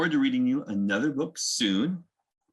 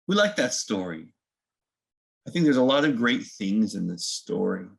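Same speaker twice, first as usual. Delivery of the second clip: ordinary delivery, nothing marked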